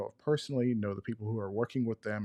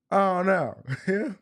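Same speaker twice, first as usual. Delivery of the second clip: mock alarm; 9 semitones higher, 6 dB louder than usual. Mock dread at Glock's future.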